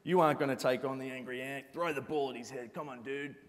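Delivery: put-on a brash American accent urging violence; American dark passenger. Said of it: "I don't know why he's American".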